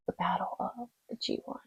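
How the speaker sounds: breathy mock-dramatic whisper of a title. Vlogger whispering a dramatic title for the viewers. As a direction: whisper it like a movie trailer.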